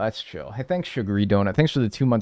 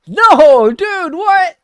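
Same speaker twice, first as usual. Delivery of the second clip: shocked yelling; 10 dB louder than usual. Real, not acted. Shocked outburst at chat prank.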